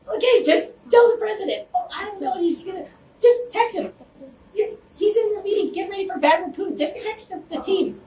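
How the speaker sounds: very high-pitched mock flustered voice; imitated frantic exchange. Retelling urgent calls about texting the president, mock frantic voice.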